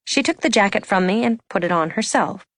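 ordinary delivery

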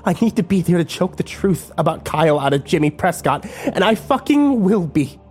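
determined female voice; fierce vow. A narrator's reading. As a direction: swear it through teeth. Kimber vowing.

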